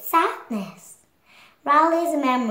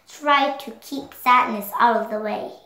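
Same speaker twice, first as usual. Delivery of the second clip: plain reading voice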